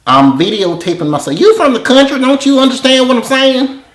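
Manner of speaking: loud, high exasperated southern twang; exasperated repeating. Real, not acted. Husband repeating himself to a caller who won't understand, exasperated. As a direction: climb to a high, loud complaint.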